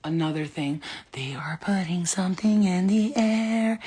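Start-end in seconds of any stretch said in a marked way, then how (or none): 1.1-3.8 whispered secret, slow; conspiratorial whisper. Acted. Warning viewers about the air, slow whisper. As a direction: hush it like a secret.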